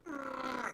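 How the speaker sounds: high, soft acted grunt; strained grunt. Retelling the hook going through his finger, strained grunt. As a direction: imitate a strained groan, kept soft.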